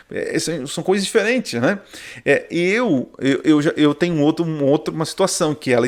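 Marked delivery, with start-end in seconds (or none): none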